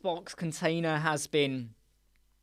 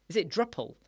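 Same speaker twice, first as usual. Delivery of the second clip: ordinary talk, nothing marked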